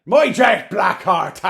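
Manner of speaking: loud, slow and laughing; loud excited slow shout. Real, not acted. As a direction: belt it out with a laugh. Excited outburst naming beer.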